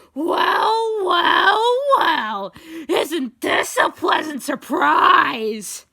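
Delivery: high, very loud theatrical villain; gloating villain. A narrator's reading. X greets captured Steve, gloating loudly. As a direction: draw it out in mocking triumph.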